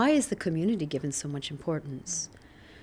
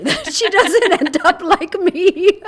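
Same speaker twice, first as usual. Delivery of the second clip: laughing; 12 semitones higher, 12 dB louder, slower than usual. Retold or imitated. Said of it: "I look at her and I think".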